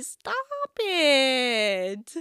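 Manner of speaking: usual speaking voice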